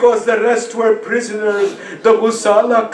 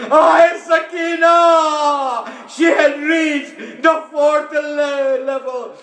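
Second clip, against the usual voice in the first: lamenting cry; 5 semitones higher than usual. Real, not acted. Preacher crying out to mourners in lament. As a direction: cry out in real grief.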